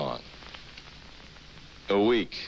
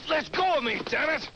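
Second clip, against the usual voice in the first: high, strained cry while struggling; angry protest. Acted. Doctor held by Rackham's men, angry protest. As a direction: demand release, voice pitched far up.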